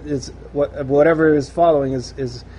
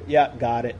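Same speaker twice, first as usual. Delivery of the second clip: bored brush-off; 7 semitones lower, 4 dB quieter than usual. Retold or imitated. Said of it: "and, and zone out".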